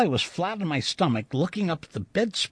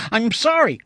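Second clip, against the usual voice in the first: high, loud, urgent apology; hasty apology; 8 semitones higher, 7 dB louder than usual. A narrator's reading. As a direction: blurt out an apology. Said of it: "I said quickly".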